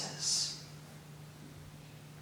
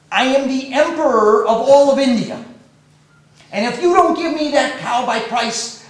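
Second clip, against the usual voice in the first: loud, higher royal threat; angry threat; 4 semitones higher, 7 dB louder than usual. Acted. The emperor threatening the sage, loud angry demand.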